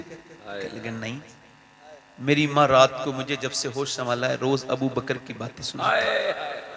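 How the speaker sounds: hushed, gentle sermon story voice; soft storytelling. A narrator's reading. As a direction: draw them in softly. (धीमी आवाज़ में कहानी सुनाना)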